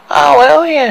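high, loud nervous yelp; jumpy and startled. A narrator's reading. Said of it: "said Totty nervously".

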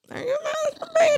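squeaky, high shocked question; high mock-horrified question. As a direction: ask it in hammed-up horror. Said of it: "it's just like a".

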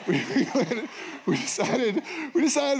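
talking through a laugh. Real, not acted. Pastor laughing as he starts the story. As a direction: laugh while getting the words out.

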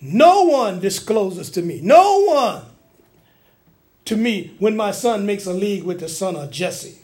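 slow, wailing indignant rant; aggrieved king railing at his people; going off. Acted. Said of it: "he went off on his own people".

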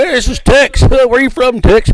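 lightly put-on movie cowboy hailing someone; cowboy movie guy. Host mimicking the stock cowboy guy in space movies, hammed-up. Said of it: "there's always that one guy".

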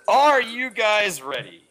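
booming, drawn-out host call; game show host voice. Acted. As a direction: announce it like a radio host. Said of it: "put on that radio voice".

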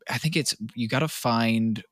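ordinary talk

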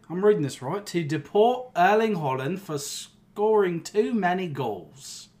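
lightly put-on British MP voice, slowed on the petition; a hint of a pompous parliament member, unhurried; mock British accent. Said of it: "I'd like to see you do better".

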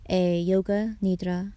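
normal talking voice

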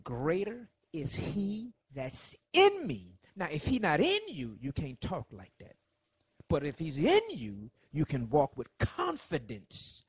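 slow, emphatic declaration; slow preaching. Acted. Preacher declaring confidence to the congregation, slow and emphatic. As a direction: lean into each word slowly.

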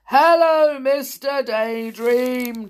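high, loud trumpeting greeting; trumpeting elephant. A narrator's reading. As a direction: blare it out like an elephant. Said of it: "trumpeted the elephant".

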